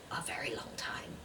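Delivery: hushed, voiceless confession; whisper. Real, not acted. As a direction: whisper it as a sheepish aside. Guilty aside on borrowed book.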